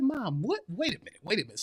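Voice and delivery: lightly acted astonishment; retold disbelief. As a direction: halt her story in hushed disbelief. Retold disbelief, interrupting mother's story.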